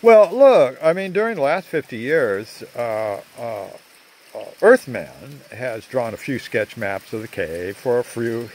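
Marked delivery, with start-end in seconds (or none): none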